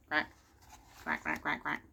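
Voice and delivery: low quacking duck sounds; duck quacks. Acted. Teacher quacking with the ducks in the park. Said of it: "How does a duck sound?".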